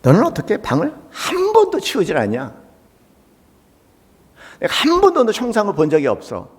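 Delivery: high, exasperated nagging; scolding mother. Retold or imitated. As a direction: nag like a fed-up mother. Acts out mother scolding son.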